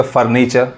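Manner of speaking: फर्नीचर with both r's sounded; common mispronunciation. Shown as a demonstration. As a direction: say फर्नीचर as 'far-nee-char'. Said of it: "ये फर्नीचर नहीं पढ़ाएगा". (फर्नीचर में दोनों 'र' बोलें)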